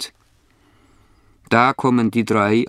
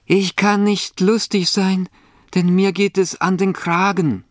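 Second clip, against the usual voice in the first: high-pitched voice of a miserable cat; unhappy old cat, pitched way up; woeful cat; 11 semitones higher than usual. A narrator's reading. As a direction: lament in a high storybook cat voice. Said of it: "antwortet die Katze".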